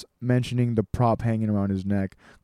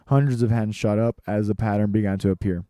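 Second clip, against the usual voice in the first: plain reading voice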